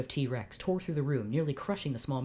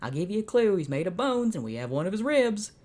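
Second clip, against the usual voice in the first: Jed cowboy voice; 5 semitones higher, 5 dB louder than usual. Acted. Reader voicing the toy cowboy Jed asking a favour, high cowboy voice. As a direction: play the little cowboy in a hurry.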